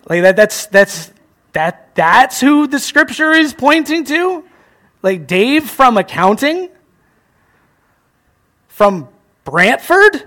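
slow, drawn-out, incredulous question; mock disbelief. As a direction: play the doubter, stretching out the absurdity. Nate doubting Jesus' humble origins.

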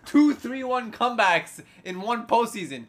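excited live play-by-play; frantic mock sports commentary. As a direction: call the game like an announcer. Live play-by-play over the clip.

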